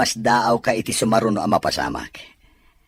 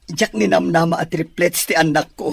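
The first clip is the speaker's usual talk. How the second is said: joyful thanks; 7 semitones higher, 4 dB louder than usual. Acted. Thanking wife for triplets.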